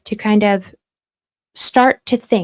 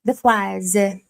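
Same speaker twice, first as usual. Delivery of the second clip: put-on amateur French accent, "French" as "fwah-z"; struggling French accent. Streamer embarrassed before chat, struggling French accent. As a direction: attempt "The French" in a clumsy French accent. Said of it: "struggling through a French accent".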